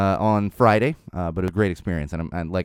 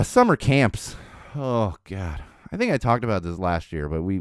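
ordinary talk

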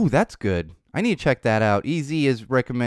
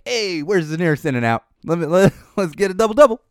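excited, higher, louder mock craving; late-night craving; 7 semitones higher, 5 dB louder than usual. Sister and me after a show, excitedly craving burgers. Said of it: "we're like".

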